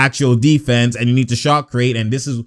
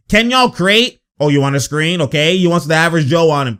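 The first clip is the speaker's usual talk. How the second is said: usual speaking voice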